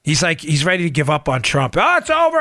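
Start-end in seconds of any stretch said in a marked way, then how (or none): 1.8-2.4 high-pitched mock wail of defeat; mock scream. Host imitates upset emailer giving up on Trump, mock screaming. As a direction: imitate a panicked ranter, pitched up. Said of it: "Ah, he's screaming".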